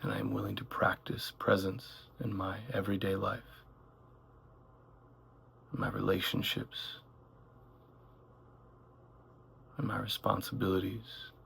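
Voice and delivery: calm meditation voice, gradually softening; unhurried phrases with long silences between them; guided meditation. Acted. Guide leading listeners through one breath at a time, guided meditation.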